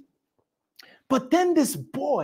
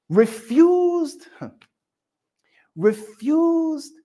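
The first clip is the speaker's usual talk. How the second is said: preacher relishing David's refusal, emphatic then whispered; emphatic refused; 6 semitones higher, much slower than usual. Acted. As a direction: savor the word refused. Emphatic repeated word.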